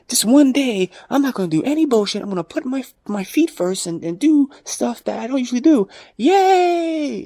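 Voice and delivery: high, sing-song mock cheer; mocking hyped protester. Mocking the one-day protester, high and giddy.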